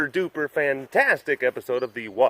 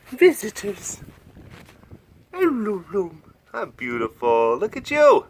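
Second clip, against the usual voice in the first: high, bright-eyed cat voice; tiger greeting visitors; 8 semitones higher than usual. Pretend. Tiger voiced at the keeper, thrilled to see visitors. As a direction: play the tiger, delighted at company.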